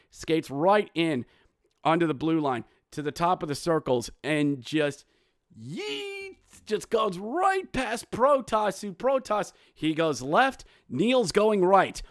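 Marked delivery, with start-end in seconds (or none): 5.6-9.5 excited squeak. Real, not acted. Describing a wild shot.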